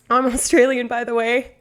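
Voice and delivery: badly faked Australian accent; terrible Australian accent. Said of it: "my terrible accent".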